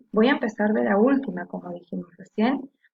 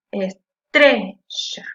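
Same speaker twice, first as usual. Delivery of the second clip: "Es trecha" split in syllables, "tre" hit louder; syllabes détachées; 5 dB louder, much slower than usual. Shown as a demonstration. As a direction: slowly break up "Es trecha", punching "tre". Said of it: "la que suena con más fuerza".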